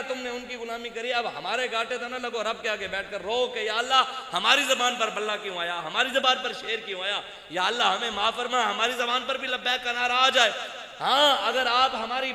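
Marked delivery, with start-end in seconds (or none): none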